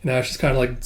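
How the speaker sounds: ordinary delivery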